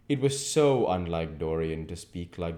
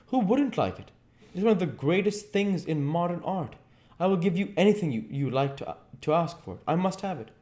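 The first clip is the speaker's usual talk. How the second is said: lightly voiced eager lord; higher, quick and covetous; a hint of a greedy collector; Lord Henry; 12 semitones higher, 4 dB louder, faster than usual. A narrator's reading. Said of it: "said Lord Henry".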